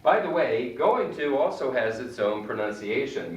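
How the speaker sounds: ordinary talk, nothing marked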